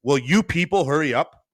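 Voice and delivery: loud, high furious snap; snapping. Retold or imitated. Rude customer yelling at the sandwich girls, loud and high. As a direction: bite their heads off over the sauce. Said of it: "is snapping at these young".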